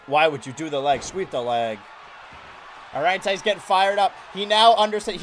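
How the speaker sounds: hyped ringside play-by-play; wrestling commentator. Acted. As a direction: call the match live from ringside. Live match play-by-play.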